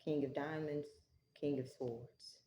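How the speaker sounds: ordinary talk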